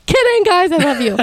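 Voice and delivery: high-pitched mock-sweet retraction, then settling; playful backtracking. Host to viewers after teasing the French, playfully placating.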